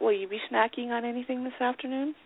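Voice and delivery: ordinary talk, nothing marked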